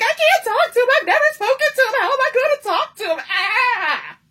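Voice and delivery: very high, loud, laughing panic; panicked squeal. Retold or imitated. Fan told to talk to her crush, high laughing panic. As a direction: shriek in giddy dread. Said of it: "And I'm like".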